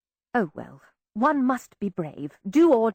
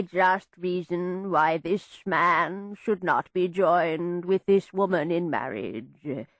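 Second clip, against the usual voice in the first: very slow ceremonial intoning; droning vicar; 3 semitones lower, slower than usual. A narrator's reading. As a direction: drone it like a sleepy vicar. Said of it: "the vicar's drone".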